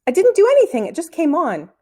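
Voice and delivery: put-on high, loud boyish protest; defensive protest. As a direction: insist you did nothing, voice up high. Boy denies blame, protesting.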